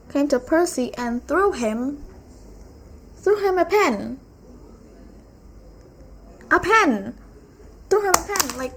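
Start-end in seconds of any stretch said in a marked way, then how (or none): 3.2-8.6 loud disbelieving exclamation; incredulous. Retold or imitated. Retelling the pen scene, exclaiming in loud disbelief. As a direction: act shocked someone threw a pen.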